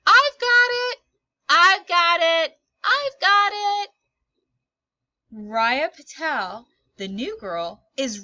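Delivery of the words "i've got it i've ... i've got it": high, loud shouting to claim the catch; calling out. Acted. Raya running for the falling ball, shouting loudly. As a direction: yell it across the field as you run. New girl racing for ball.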